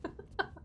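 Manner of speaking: soft, high giggle; laugh. Real, not acted. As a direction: let out a small quiet giggle. Amused after "Oh my god.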